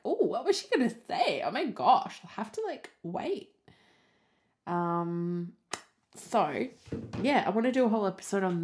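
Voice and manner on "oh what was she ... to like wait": high, gasping mock suspense; a lightly voiced eager fan; eager listener. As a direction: play the impatient listener left hanging. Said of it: "so you'll be like".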